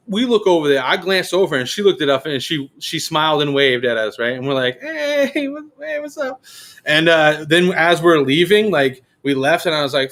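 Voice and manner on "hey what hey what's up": high, soft sing-out hello; friendly mock greeting. Crew greet a waving lady, high friendly hello. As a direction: call a small, high-pitched hey.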